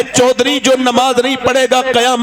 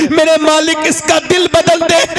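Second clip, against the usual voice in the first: preaching at full force; 10 semitones higher than usual. Acted. Preacher to the crowd, building to a climax, full-force preaching shout. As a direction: preach it out with everything you have.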